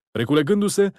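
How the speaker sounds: usual speaking voice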